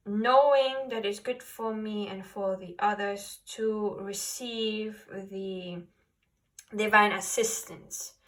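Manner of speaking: usual speaking voice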